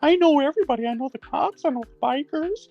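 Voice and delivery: very high squeaky voice, bragging; mocking Rick. Streamer mocking Rick's tough talk in a squeaky voice. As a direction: squeak out empty threats like a know-it-all. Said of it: "this is Rick here".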